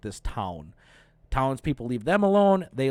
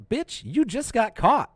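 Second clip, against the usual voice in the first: higher, sassy call-out; mock call-out; 8 semitones higher than usual. Reacting to the girl's lie, high and sassy. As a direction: call her out, amused. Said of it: "So I'm like".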